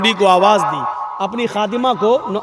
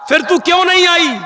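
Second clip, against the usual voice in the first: stern demand; 6 semitones higher, faster than usual. Retold or imitated. Prophet demands answer.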